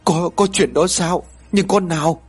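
astonished, high-pitched questioning; stunned. A narrator's reading. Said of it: "Bà Mai sửng sốt nói".

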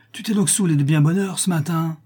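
high, indignant reproach; angry accusation. A narrator's reading. Elder angrily accuses trainer.

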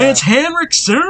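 catchphrase yell. Pretend. Co-host showing what a hype man would say, playful yell. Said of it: "yelling catchphrases at each other".

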